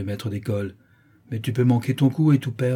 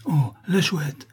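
higher, slow drawn-out cry of fright; frightened cry; 6 semitones higher, much slower than usual. A narrator's reading. Tortillard begging la Chouette not to kill, frightened. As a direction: plead in alarm, voice lifted. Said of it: "s'écria Tortillard avec effroi".

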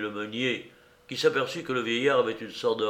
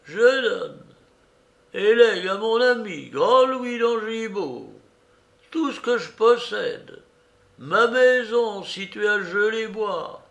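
put-on high-pitched, emphatic old beggar; 9 semitones higher, 5 dB louder than usual. Beggar dictating his will to the notary, high imperious voice.

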